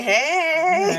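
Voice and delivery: high, drawn-out mock flirty hey; playful flirty greeting.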